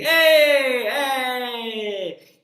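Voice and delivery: cool Fonzie style hey, high and loud; a loud Fonzie greeting. Shown as a demonstration. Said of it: "that's more like Fonzie".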